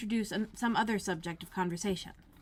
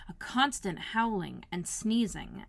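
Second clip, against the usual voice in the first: ordinary delivery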